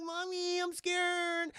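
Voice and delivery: put-on high, small scared child voice; high little girl voice. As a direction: whimper it up high like a kid.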